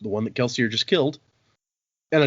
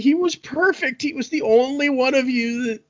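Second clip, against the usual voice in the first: high, hammed-up wailing protest; outraged rant; 13 semitones higher, 3 dB louder than usual. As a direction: cry it out in indignant despair. Acting out god's outraged rant.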